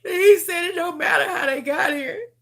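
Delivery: fighting back sobs while she speaks; crying. Real, not acted. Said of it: "I'm sorry for crying".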